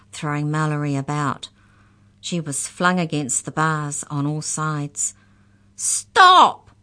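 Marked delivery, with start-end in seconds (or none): 5.8-6.7 high, very loud cry; shriek. A narrator's reading. Mallory, flung against the bars, shrieking. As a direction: shriek it out desperately. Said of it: "she shrieked".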